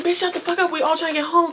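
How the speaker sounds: high-pitched, rapid-fire mock scolding; retold telling-off. Storyteller replaying how she told the passenger off, mock scolding. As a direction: act out snapping at someone, pitched well up. Retelling her outburst at crying passenger.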